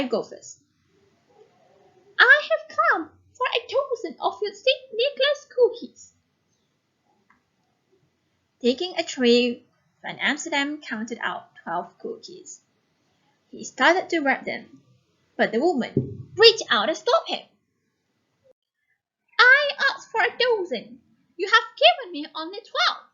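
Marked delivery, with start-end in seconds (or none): 2.2-6.1 put-on high, thin old woman's voice. Shown as a demonstration. Teacher voicing the old woman for the class. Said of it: "change our voice to be a little bit small". 19.4-23.0 put-on high, insistent old woman's complaint. Shown as a demonstration. Old woman complaining to the baker about the dozen.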